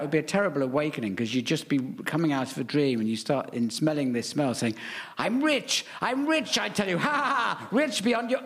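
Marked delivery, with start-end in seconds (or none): 5.2-8.4 high, gloating cries of triumph; mock exultant. Sleeper waking to the smell of dollars, exultant high voice. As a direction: crow it like a deluded new millionaire.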